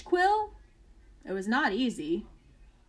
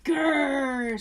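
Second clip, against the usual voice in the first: growl; 4 dB louder than usual. Acted. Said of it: "said the rhinoceros".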